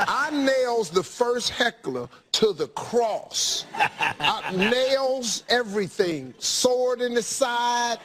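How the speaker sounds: slow, drawn-out mock-epic boast; slow hammed-up preacherly proclamation. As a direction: stretch each word out for comic grandeur. Mock-biblical boast about crucifying a heckler.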